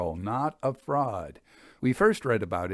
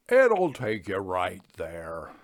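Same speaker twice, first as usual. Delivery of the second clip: slow, lower country voice; lightly voiced villager giving directions; taciturn local; 5 semitones lower, slower than usual. A narrator's reading. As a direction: drawl it out like a laconic farmhand. Native gives directions.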